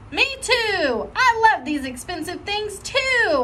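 put-on high, eager child's echo; eager copying. Junie copying Lucille to fit in, high and eager.

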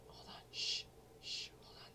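hushed unvoiced whisper. Real, not acted. Whispering while sneaking up on enemies, hushed. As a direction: keep it to a breath, sneaking.

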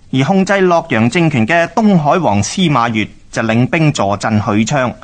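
ordinary delivery

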